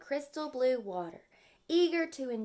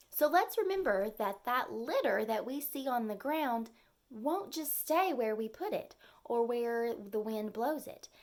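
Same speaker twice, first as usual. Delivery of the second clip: plain reading voice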